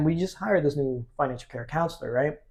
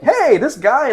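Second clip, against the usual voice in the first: excited; 10 semitones higher, 6 dB louder, slower than usual. Retold or imitated. Coworker tells her dad.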